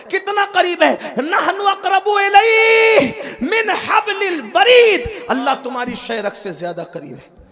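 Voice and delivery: high, loud, joyous shouted preaching; preaching at full force. Acted. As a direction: lift the crowd with each verse. Preaching climax, shouted verses.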